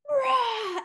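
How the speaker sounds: a high, loud playful "Rah!" to scare; mock monster roar. Mom leaping out at her daughter from behind the tree, playful scare roar. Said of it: "And I'm like".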